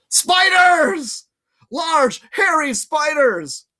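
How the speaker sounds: high, loud, slow shout; shouted warning. Acted. Percy shouting spiders at Annabeth to jar her, high and loud. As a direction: jolt her with a horror.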